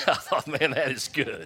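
high, loud, laughing through it. Real, not acted. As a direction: talk while still cracking up.

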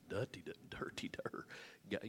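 hushed, half-whispered mock duh. Preacher voicing what everyone thinks, hushed mock duh. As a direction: say it under your breath, like a silly thought. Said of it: "We all think".

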